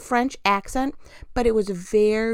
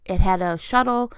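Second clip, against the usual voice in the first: ordinary talk, nothing marked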